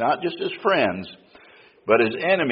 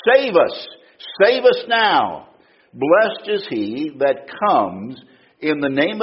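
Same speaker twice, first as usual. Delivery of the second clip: crowd crying out. Acted. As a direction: cry it out like a pleading crowd. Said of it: "continually crying".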